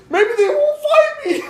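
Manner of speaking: very high, loud mock-girl voice; hammed-up falsetto, breaking into laughter; hiding girl. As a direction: imitate a girl squealing from her hiding place. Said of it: "She went behind the clothes".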